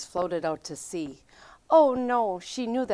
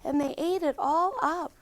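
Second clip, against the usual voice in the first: high little cub voice; small bear cub, high and upset; high-pitched baby bear; 10 semitones higher than usual. A narrator's reading. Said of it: "sputtered Baby Bear".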